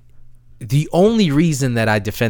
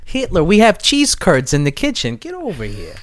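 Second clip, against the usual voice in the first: hammed-up Minnesota hostess; folksy Minnesotan invitation; Minnesotan accent; 6 semitones higher than usual. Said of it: "where that accent's going".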